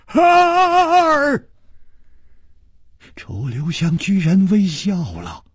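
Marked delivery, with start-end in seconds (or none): 0.1-1.5 very high, loud strained shout; 嘶哑地喊; hoarse shout. A narrator's reading. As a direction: yell the count through a tight throat. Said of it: "李玉涵哑着声音又喊".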